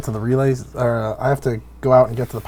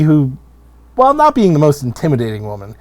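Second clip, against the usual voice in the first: ordinary talk, nothing marked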